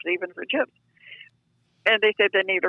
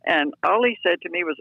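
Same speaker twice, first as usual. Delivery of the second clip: ordinary talk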